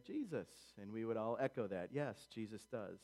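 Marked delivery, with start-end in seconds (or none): 0.0-0.7 lightly voiced toddler, pitched up; a hint of a little kid's higher voice; small child's answer. Preacher answering as two and three-year-olds would, lightly childlike. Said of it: "Two and three-year-olds would say".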